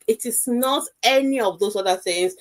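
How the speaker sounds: ordinary talk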